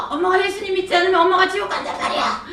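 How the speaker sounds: high, fast, exasperated yell; angry outburst. Retold or imitated. Daughter warning her mother about hell, angry outburst. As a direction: throw it at her in frustration. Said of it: "엄마한테 제가 막 화를 냈어요".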